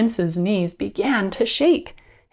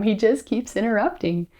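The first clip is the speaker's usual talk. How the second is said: plain reading voice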